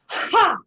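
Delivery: loudest, highest Ha, all-out shout; full shouted Ha. Shown as a demonstration. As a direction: give everything on the last Ha. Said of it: "Last one as loud as you can".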